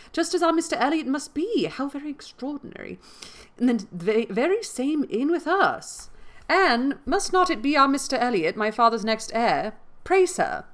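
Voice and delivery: high-pitched, eager gushing; animated, insistent Mary; excited cry. A narrator's reading. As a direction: exclaim each thought as it strikes. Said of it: "cried Mary".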